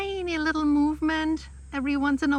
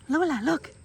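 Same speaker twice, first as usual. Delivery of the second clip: normal talking voice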